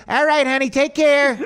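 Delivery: fake-sweet mobster. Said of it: "He's like".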